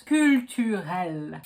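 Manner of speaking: slow "culturel" with pursed U and throaty R; correct French pronunciation. Shown as a demonstration. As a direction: say "culturel" ending like ginger ale. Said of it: "you know how to pronounce".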